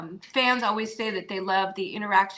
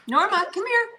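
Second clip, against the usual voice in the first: calling the dog; 13 semitones higher than usual. Real, not acted. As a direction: call your pet over.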